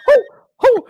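very high, loud cartoon mouse; Mickey Mouse voice. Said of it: "or like Mickey Mouse".

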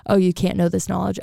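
usual speaking voice

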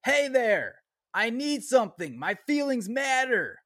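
high-pitched reading with exclamation points; mock yelling. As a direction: cry it out high, as if exclamation-marked. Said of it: "That's why I'm yelling".

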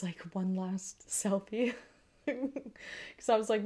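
ordinary talk

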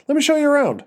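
eager realtor greeting; chipper realtor voice. A narrator's reading. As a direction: sell the house with a big smile. Realtor character voiced in story.

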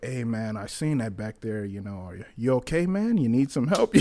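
soft, low check-in; imitated hushed concern. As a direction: quietly imitate people gently asking if he's okay. Voicing concerned passers-by in a retold scene.

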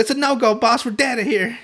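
squeaky henchman giving up; dopey bandit, laughing. Retold or imitated. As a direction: report the failure like a doomed minion. Bandits failing at the wall.

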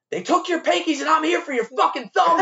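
high, loud menacing yell; threatening yell. Retold or imitated. Waiter yelling a threat at the customer in the parking lot. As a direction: threaten him with real menace.

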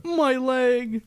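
high mock cartoon cry; lightly hammed-up cry of pain; cartoon catchphrase. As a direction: toss off the cartoon yelp. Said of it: "besides the".

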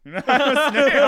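posh snake voice collapsing into laughter; laughing prim British snake. Pretend. Friend voices the British snake, laughing through it. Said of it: "the snake had, like, a really proper, prim, like, British accent".

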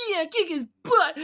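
loud, high mocking echo; exaggerated high-pitched mimicry; mocking imitation. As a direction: parrot the heckler back in a silly voice. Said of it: "just mocking her".

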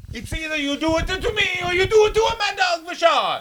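very high, shouted caricature; Pat Patterson. Pretend. Wrestler giving an ultimatum, in a high, shouted comic voice. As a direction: holler it in a squealing cartoon voice. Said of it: "that's what Pat Patterson sounds like".

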